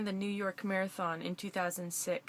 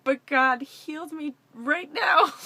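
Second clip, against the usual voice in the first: laughing, loud and high with joy; bursting out the good news with a laugh; excited exclaiming; 5 semitones higher, 8 dB louder than usual. Retold or imitated. To the man on the sidewalk, excited and laughing. Telling a stranger about healing.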